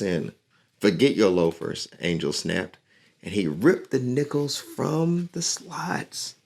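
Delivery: lively storybook reading; reading to children. A narrator's reading. Teacher reading a picture book to her class, lively. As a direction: read the story aloud to young listeners.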